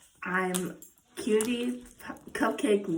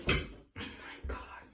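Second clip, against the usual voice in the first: whispered; 12 dB quieter, slower than usual. Real, not acted. Startled during dog bath.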